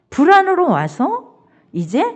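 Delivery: ordinary delivery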